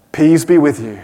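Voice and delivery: low, understated peace be with you; flat low greeting. Shown as a demonstration. Pastor's deliberately limp first try at Jesus' greeting, low. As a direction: say it low and offhand, almost throwaway. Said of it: "No, of course he said".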